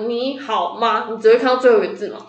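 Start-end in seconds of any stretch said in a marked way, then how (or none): 0.1-1.1 drawn out very slowly, word by word; slow demo. Shown as a demonstration. Explains phone previews by reading a sample message slowly. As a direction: spell out the message slowly as an example. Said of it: "比如说我传给你三句话".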